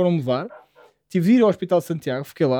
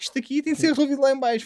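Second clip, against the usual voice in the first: brushing off doctor; 8 semitones higher, faster than usual. Retold or imitated. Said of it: "só mandaram, tipo".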